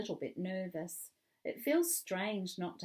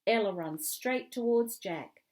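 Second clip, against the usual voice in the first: usual speaking voice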